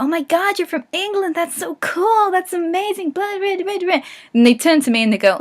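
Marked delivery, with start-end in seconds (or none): 0.0-4.0 high-pitched imitation of starstruck fans; excited fangirl impression, tailing into blahs; mock gushing. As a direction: gush in mock amazement, much higher. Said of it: "So people would go to him and go".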